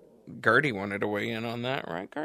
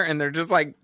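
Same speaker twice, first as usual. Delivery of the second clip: normal talking voice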